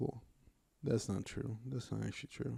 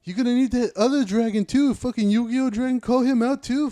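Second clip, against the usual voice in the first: high, loud hammed-up challenge; mock trash talk; 8 semitones higher, 6 dB louder than usual. Fighter warning rivals to bring help, loud mock taunt. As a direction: escalate into comic fighter ranting.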